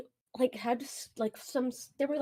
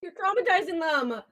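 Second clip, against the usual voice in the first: loud, high scolding; mock shout; 8 semitones higher, 7 dB louder than usual. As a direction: shout it like you're stopping them. Shouting at imagined brother.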